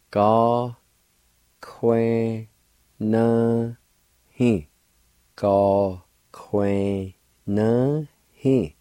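Gá cúin na hí said very slowly; slow drawn-out demo. Shown as a demonstration. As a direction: stretch each syllable out for the learner. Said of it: "Pronounce like this".

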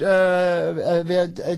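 ordinary talk, nothing marked

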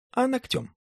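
put-on sly girl fibbing sweetly; high young woman voice. Tatyana lies lightly.

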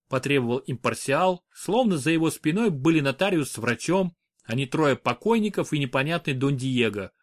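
ordinary delivery, nothing marked

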